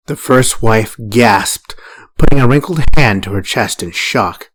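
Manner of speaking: plain reading voice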